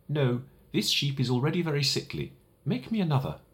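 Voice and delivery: put-on a small boy correcting a grown-up; high, earnest little prince refusing; little prince, insistent. Little prince rejects drawing.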